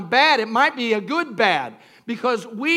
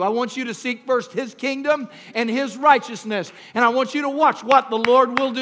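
ordinary delivery

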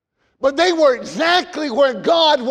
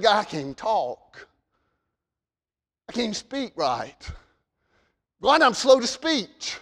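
timid complaint; 4 dB quieter than usual. Retold or imitated. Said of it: "Moses said".

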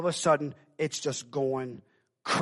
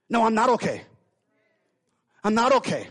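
desperate denial; 7 semitones higher, 8 dB louder than usual. Acted. Voiced woman refusing comfort.

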